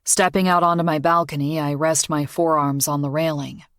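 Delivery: ordinary delivery, nothing marked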